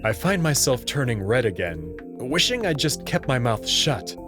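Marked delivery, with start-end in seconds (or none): none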